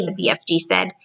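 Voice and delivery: usual speaking voice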